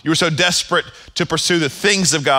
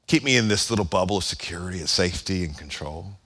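much softer, sinking into a comfortable murmur; mock cozy prayer; 8 semitones lower, 7 dB quieter than usual. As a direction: pray like someone hiding in a safe bubble. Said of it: "here's what I mean".

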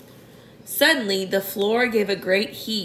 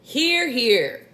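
mildly raised cheer; shouted agreement; 3 semitones higher than usual. A narrator's reading. All the creatures agreeing with the ladybird, mild shout. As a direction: repeat the cheer, a little held back. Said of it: "they all shouted".

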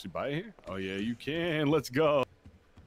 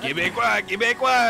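put-on loud high Québécois accent; 12 semitones higher, 11 dB louder than usual. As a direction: belt out Québécois, French style. Loud high mock French.

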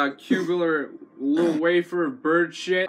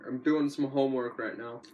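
quiet aside; 7 semitones lower, 7 dB quieter than usual. Real, not acted. Quiet aside, soft and low.

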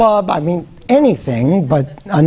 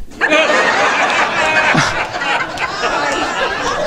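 high cackling laugh; 8 semitones higher, 3 dB quieter than usual. Real, not acted. Laughing at own punchline.

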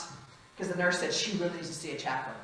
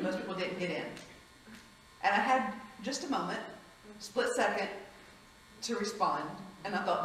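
ordinary talk, nothing marked

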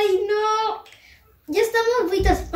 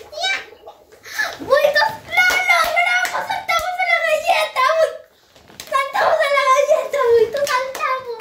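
excited cheer; 10 semitones higher than usual. Real, not acted. Celebrating jump onto the cookie.

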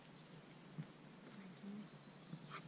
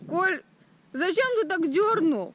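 sharp, raised reproach; annoyed scolding; 6 semitones higher, 5 dB louder than usual. Real, not acted. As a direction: scold him for yanking it. Wife scolds husband over rod.

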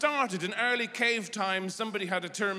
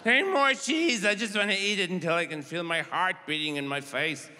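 greedy glutton voice. Acted. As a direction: beg for cheese like a greedy slob. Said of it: "blodging around going".

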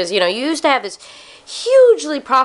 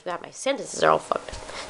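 muttered under breath; 4 semitones lower, 9 dB quieter than usual. Real, not acted. Muttering at own mistake.

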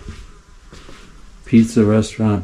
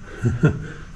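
soft laugh; 5 dB quieter than usual. Real, not acted. Amused by creepy scarecrow.

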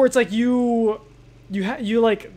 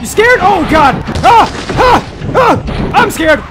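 loud, high screams, half playing at terror; screaming panic; 8 semitones higher, 8 dB louder, slower than usual. Real, not acted. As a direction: shriek and flee, half joking. Chase, screaming in mock terror.

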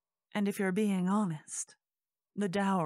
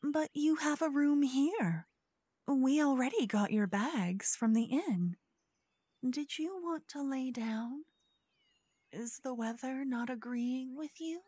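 sweet scheming hostess; 7 semitones higher than usual. Acted. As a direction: coax the guest to stay, sugar over suspicion. Host character speaks to guest.

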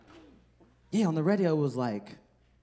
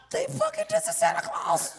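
stoned amazed; slower than usual. Retold or imitated. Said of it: "I was like".

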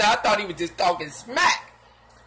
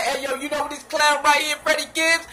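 mocking rapper; 6 semitones higher, 4 dB louder than usual. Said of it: "He said".

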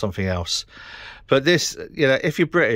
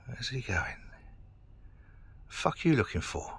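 soft muttered questions at a stranger; hushed muttering; 2 semitones higher, 10 dB quieter than usual. Real, not acted. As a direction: mutter under your breath at him.

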